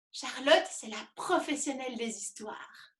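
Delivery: usual speaking voice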